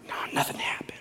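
hushed, whispery letdown; discouraged aside. Retold or imitated. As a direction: drop to a deflated whisper. Discouraged in the lobby.

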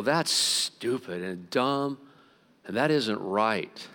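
drawn-out, slow, disgusted verdict. Retold or imitated. Pastor scorning paywalled sermons, slow and disgusted. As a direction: spell out his disgust slowly, word by word. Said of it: "And I go".

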